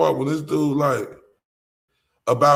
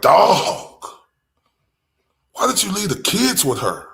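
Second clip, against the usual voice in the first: loud, pitched-up demand; exasperated retort; 6 semitones higher, 6 dB louder than usual. Retold or imitated. Vlogger confronting the father, loud exasperated question. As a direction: call him out in disbelief. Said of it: "But I'm like".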